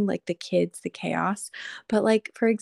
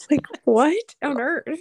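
exasperated disbelief; 7 semitones higher, 5 dB louder, slower than usual. Real, not acted. Laughing at piling troubles.

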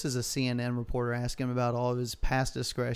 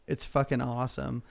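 ordinary talk, nothing marked

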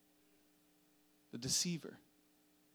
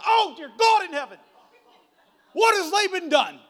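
much higher, louder panicked cry with a laugh; horrified Jacob; 13 semitones higher, 14 dB louder than usual. Acted. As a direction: wail it like a man waking to disaster. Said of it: "he wakes up and he's like".

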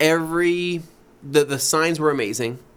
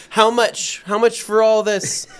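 higher, louder insistent asking; pushy customer; 7 semitones higher, 4 dB louder than usual. Retold or imitated. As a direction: pester for a price. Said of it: "They start turning".

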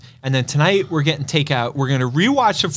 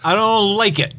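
mocking parrot; 6 semitones higher than usual. Co-host parrots him mockingly.

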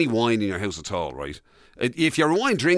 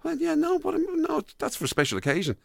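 high, hammed-up dithering refusal; mimicking himself; 14 semitones higher, 4 dB quieter than usual. Husband refusing his wife's request to open the wine, high and dithering. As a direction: squeak out the excuse to save the bottle. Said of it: "and you go".

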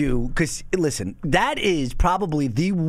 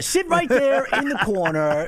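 higher, slow, play-acted scolding; mock probation order; 6 semitones higher, slower than usual. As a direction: lightly act out sending someone off. Plays along with the joke sentence.

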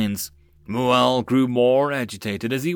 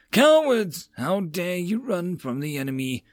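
furious outburst; 6 semitones higher than usual. A narrator's reading. General glares at his men.

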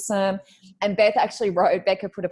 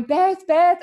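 calling out; 10 semitones higher, 6 dB louder than usual. Retold or imitated. Calling friend on bad call.

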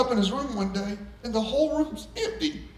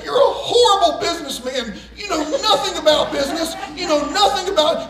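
loud laughing scold; hammed-up shouting at son; mock screaming; 6 semitones higher, 6 dB louder than usual. Dad screaming at his son over the phone. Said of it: "crying and screaming at each other".

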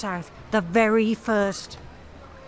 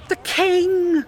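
startled gasp; 5 semitones higher than usual. Acted. Nervous organiser spotting the king, startled gasp. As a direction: catch your breath, then blurt it.

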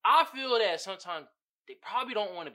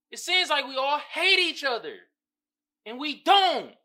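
ordinary delivery

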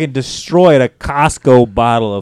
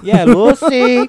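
slow, drawn-out, much higher; Ricky Ricardo impression; 10 semitones higher, 4 dB louder, much slower than usual. Joking about girlfriend's mom, Ricky Ricardo scolding Lucy. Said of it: "You got some explaining to do".

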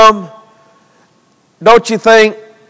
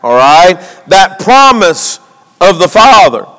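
usual speaking voice

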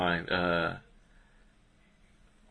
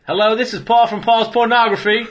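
mock English accent, higher and louder; 8 semitones higher, 4 dB louder than usual. Prank caller in a mock English accent. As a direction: put on a posh English phone voice. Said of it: "it's hard to keep up that accent now".

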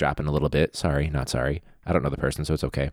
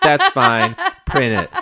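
much higher, louder giggly clerk voice; mock resigned clerk; 6 dB louder, slower than usual. Clerk caving to a rainbow photo, squeaky and laughing.

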